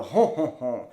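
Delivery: mock French-man "hon hon hon"; a hint of movie Frenchman, rounded nasal honks. Shown as a demonstration. Teacher imitating the French man from movies, mock honking. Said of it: "stereotypical French man sound".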